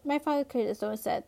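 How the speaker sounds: usual speaking voice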